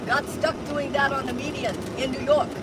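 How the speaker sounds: light mock Russian accent; broken English, lightly Russian. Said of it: "You have a terrible Russian accent".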